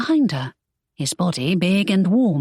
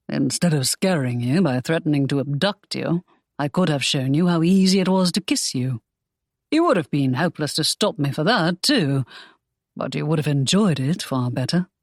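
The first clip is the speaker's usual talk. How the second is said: lower, teasing hero; Draco, wry; 3 semitones lower than usual. Acted. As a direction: tease her with a wry tone. Draco teasing about a kiss.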